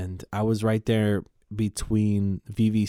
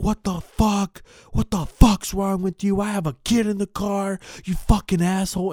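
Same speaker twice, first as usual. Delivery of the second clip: angry driver; 10 semitones higher than usual. Retold or imitated. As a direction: imitate the enraged guy whose car got hit. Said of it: "he gets out and he's like".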